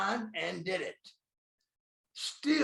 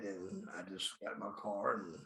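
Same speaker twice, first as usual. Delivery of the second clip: usual speaking voice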